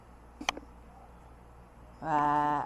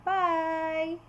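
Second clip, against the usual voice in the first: cheerful bye; 8 semitones higher than usual. Shown as a demonstration. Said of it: "you say goodbye".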